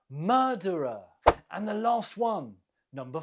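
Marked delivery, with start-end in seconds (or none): 0.1-1.0 "Murderer" stressed on MUR, falling away after; stress on first syllable. Shown as a demonstration. Teacher models the word for learners, MUR stressed in "Murderer". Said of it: "The stress is on the first syllable".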